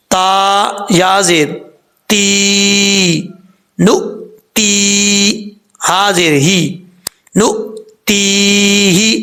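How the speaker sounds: slow spelled-out syllables नु ती ही; syllable spelling. Shown as a demonstration. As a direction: break the word into नु ती ही. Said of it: "कैसे?".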